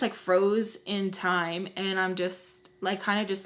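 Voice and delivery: normal talking voice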